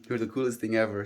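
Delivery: ordinary talk, nothing marked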